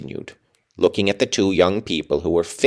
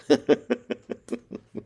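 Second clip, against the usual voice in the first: brief amused laugh; a quick laugh at yourself; short laugh; 3 semitones higher, 4 dB quieter than usual. Real, not acted. Laughs at own reading.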